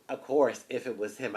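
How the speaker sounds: usual speaking voice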